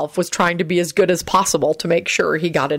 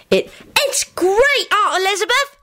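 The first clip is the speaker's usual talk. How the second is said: put-on very high, stretched small-child squeal; excited shriek. A narrator's reading. Teddy races in to his aunt, shrieking excitedly. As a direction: squeal it out slowly, bursting with excitement. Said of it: "Teddy shrieked".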